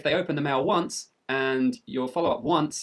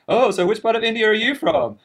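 high, louder mock question; mock joking customer; 10 semitones higher, 8 dB louder than usual. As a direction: imitate a customer with the same old joke. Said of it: "they're like".

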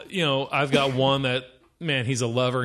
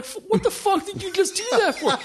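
put-on high, whining little boy; crying kid. Son blaming dad after tripping, high whine. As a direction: whine it through tears.

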